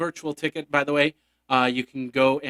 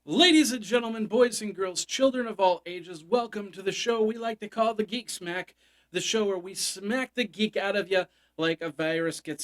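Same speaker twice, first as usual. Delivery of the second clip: grand entrance announcement; 5 semitones higher than usual. Acted. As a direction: announce it like a circus ringmaster. Show opening announcement.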